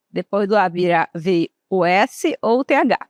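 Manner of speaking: usual speaking voice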